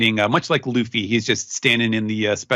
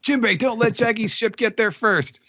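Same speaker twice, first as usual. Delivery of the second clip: high cartoon captain's voice; Luffy; 12 semitones higher than usual. Host as mad Luffy ordering his helmsman, high comic urgency. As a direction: urge the helmsman in mock indignation. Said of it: "Luffy's mad".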